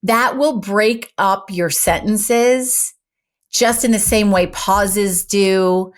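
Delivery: ordinary delivery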